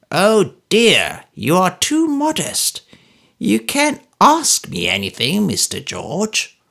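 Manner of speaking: high, slow, mocking old man's voice; old Smallweed sneering at George in high mock politeness; ogreish jocularity. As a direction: tease him like a gloating ogre. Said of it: "an ogreish kind of jocularity".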